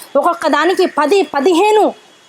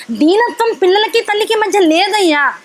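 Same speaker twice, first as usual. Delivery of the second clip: normal talking voice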